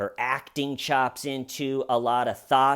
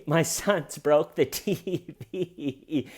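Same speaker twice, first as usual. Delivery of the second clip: slow, giggling mimic; laughing through words; 2 semitones higher, much slower than usual. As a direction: giggle through the line like her. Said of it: "if she goes".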